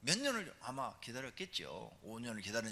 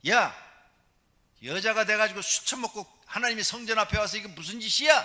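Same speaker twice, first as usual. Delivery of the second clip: loud, higher angry rebuke; scolding shout; 10 semitones higher, 11 dB louder than usual. Retold or imitated. Priest Eli scolding Hannah as drunk, shouting angrily. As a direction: yell at a drunk woman. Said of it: "하고 야단을 했어요".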